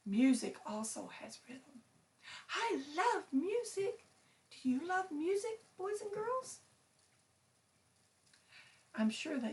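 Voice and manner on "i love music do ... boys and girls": high, eager bird voice; gushing bird character. Acted. Bird asking boys and girls about music, gushing. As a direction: gush in a high voice, rising to the question. Puppet bird gushes to children.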